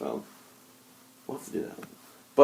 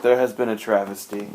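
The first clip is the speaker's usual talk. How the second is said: ordinary talk, nothing marked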